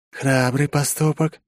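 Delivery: slow, high lizard voice; lightly voiced lizard creature. A narrator's reading. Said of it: "голос ящерки".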